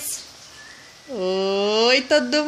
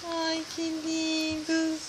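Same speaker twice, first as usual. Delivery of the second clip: soft cooing; 8 dB quieter than usual. Real, not acted. Mother cooing over babies.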